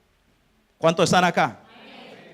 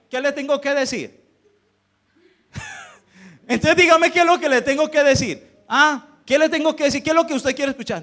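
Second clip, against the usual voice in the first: pastor que increpa, con tono agudo; reclamo exasperado; 6 semitones higher, 3 dB louder than usual. Retold or imitated. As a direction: interroga con insistencia, subiendo la voz de tono. Retold pastor confronting a church member. (reproche exasperado y agudo, recreando la discusión)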